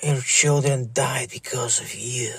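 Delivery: slow, breathy hiss of a whispered taunt; hushed menacing whisper. Retold or imitated. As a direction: whisper it like a curse. Trolling message whispered.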